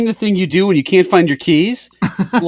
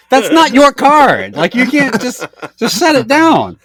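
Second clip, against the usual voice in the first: indignant protest; 8 semitones higher, slower than usual. Retold or imitated. Objecting to worker pocketing card.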